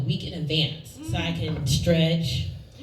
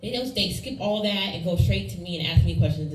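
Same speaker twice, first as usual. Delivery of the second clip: ordinary delivery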